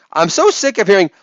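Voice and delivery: normal talking voice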